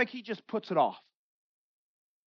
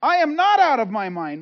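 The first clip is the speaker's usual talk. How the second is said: firm denial; 3 semitones higher, 9 dB louder than usual. Retold or imitated. Paul's firm reply to Festus.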